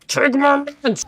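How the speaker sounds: startled high exclamation; sudden high yelp. Real, not acted. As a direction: cry out in alarm at the screen. Sudden outburst at the game.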